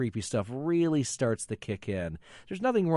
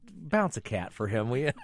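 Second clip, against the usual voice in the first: ordinary delivery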